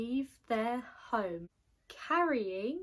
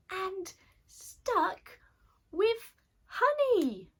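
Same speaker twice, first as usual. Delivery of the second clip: indignation climbing high and loud; wizard's protest rising into a wail; outraged trapped wizard; 7 semitones higher, 4 dB louder than usual. A narrator's reading. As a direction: build from grumble to high-pitched outcry.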